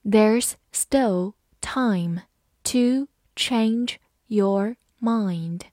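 very slow, word by word; teacher reading slowly. Shown as a demonstration. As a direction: draw the sentence out for learners. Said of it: "好，慢读一遍".

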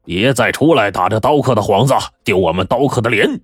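sneering bandit shouting him away; contemptuous bully. Acted. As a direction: chase the coward off, shouting 滚. Bully scolds the swordsman.

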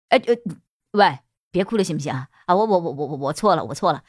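low, fast flustered man. Acted. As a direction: stammer out an apology. Boss flustered, begging her.

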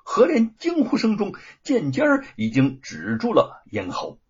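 plain reading voice

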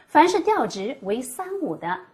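ordinary talk, nothing marked

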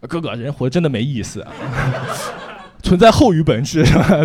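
lightly voiced little brother, laughing; mock younger brother. 模仿弟弟向哥哥说话，笑着调侃. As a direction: tease him by playing the brother. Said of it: "他会跟我说什么".

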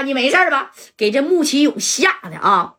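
ordinary delivery, nothing marked

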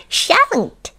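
very high, cheeky refusal; grinning goblin. Acted. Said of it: "grinned the Goblin".

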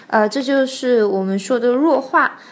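ordinary delivery

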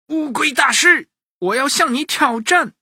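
put-on high-pitched, eager boy's challenge; excited boy challenger. A narrator's reading. Xuanxuan challenges the turtle, high and bold. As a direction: call out a challenge in a high child's voice.

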